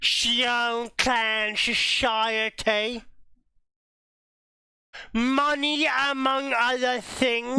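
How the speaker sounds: slow, high put-on British voice; put-on accent. Game player doing a silly voice for the table. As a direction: drawl it out in a mock accent.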